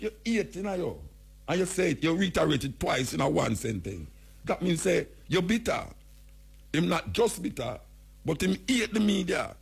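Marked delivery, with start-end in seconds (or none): none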